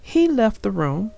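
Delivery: put-on clear, lively read-aloud for young children; storybook narration. A narrator's reading. Narrator reading Franklin's Blanket to young viewers, storybook read-aloud. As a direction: tell the story warmly to little listeners.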